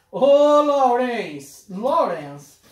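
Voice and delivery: big grateful call, loud and drawn out. Real, not acted. Thanking Lawrence for the gift, shouting his name out loud. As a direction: shout the name Lawrence across the room, delighted.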